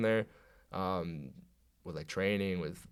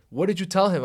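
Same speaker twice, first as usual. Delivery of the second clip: higher, louder accusing question; retold angry confrontation; 8 semitones higher, 6 dB louder than usual. Player confronts coach about telling, retold angry demand. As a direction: demand an answer, re-enacting the row.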